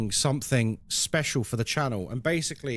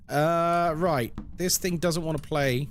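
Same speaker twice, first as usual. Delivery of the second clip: normal talking voice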